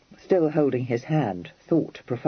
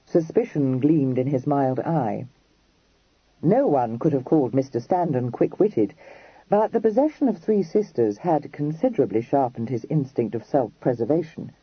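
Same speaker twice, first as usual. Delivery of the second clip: ordinary delivery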